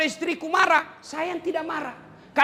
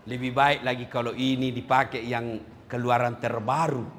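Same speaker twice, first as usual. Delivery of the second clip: dissatisfied man wanting more; 13 semitones lower than usual. Retold or imitated. Man wanting newest model.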